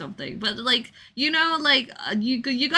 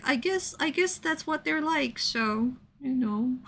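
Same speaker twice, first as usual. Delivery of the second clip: softer, higher meek shrug; Shuichi resigned; 4 semitones higher, 6 dB quieter than usual. Retold or imitated. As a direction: mumble along, unsure. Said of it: "Shuichi is more like".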